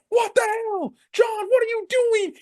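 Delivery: high-pitched aghast commentator; Michael Cole, shocked. Retold or imitated. Commentator watching the betrayal, high-pitched shock. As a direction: imitate an appalled announcer, voice shot way up. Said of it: "Michael Cole's on the fucking commentary".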